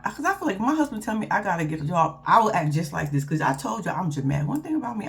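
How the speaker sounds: usual speaking voice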